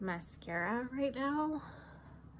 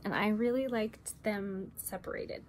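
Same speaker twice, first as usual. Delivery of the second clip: usual speaking voice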